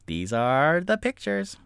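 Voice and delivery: ordinary talk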